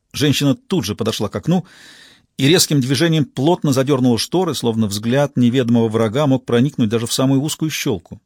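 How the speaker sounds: plain reading voice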